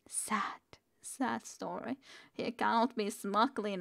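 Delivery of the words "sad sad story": hushed sad aside; whispering. Real, not acted. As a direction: breathe it out softly.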